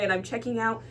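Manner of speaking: usual speaking voice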